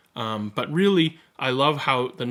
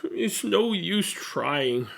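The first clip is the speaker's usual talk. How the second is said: mild sob; 6 semitones higher, much slower than usual. Acted. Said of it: "sobbed Zero".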